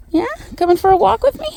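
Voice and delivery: ordinary talk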